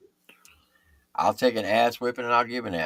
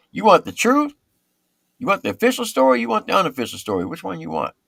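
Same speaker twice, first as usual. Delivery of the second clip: teasing challenge; 12 semitones higher, 5 dB louder than usual. Retold or imitated. Sergeant bargaining with first sergeant.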